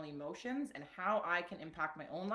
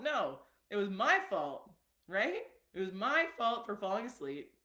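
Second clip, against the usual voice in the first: my punched louder; stress on my; 3 semitones higher, 5 dB louder than usual. Shown as a demonstration. Correcting the blame to herself, stress on my. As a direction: land hard on my in my fault. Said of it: "stress on my".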